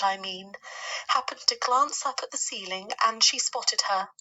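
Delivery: a fussy lady spider declaring her dislike; Miss Spider voice. Acted. Miss Spider continues her tale.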